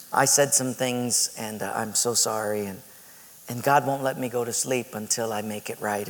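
low, soft, hesitant confession; contrite apology. Retold or imitated. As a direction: apologize as the ashamed roommate. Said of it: "his head was hung like this".

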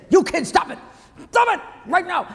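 put-on be his tiny mom yelling at the kids; shrill mom yelling. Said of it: "and she's saying".

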